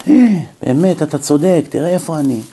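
ordinary talk

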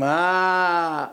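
long contented sigh; pleasured "ahh". Shown as a demonstration. Father showing sin's first pleasure, contented sigh. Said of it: "בחטא קודם כל אומרים".

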